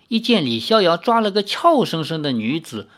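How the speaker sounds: ordinary delivery, nothing marked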